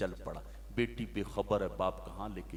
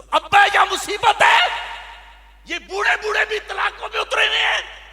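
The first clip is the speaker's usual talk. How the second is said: high, very loud chanted preacher's cry; fiery preaching; 22 dB louder than usual. Acted. Preacher lamenting a ruined nation, at full force. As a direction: cry it out to the whole crowd.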